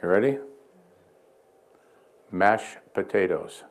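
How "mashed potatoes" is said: slow, clear test word. Shown as a demonstration. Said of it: "I'm gonna say another word".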